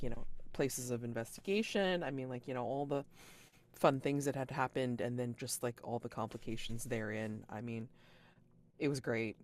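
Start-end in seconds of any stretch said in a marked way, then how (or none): none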